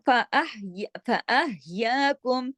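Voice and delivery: slow, drawn-out Quran recitation; chanting the verse as a teacher's model; tajweed recitation. Shown as a demonstration. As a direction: recite it slowly with careful tajweed. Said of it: "हा जो है गले में सांस चलती है".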